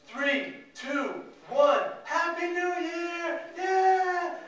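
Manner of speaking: rising countdown into a big cheer. Retold or imitated. Staff cheering the New Year countdown, high and loud. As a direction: shout it like a crowd at midnight.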